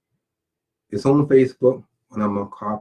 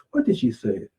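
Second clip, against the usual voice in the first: ordinary talk, nothing marked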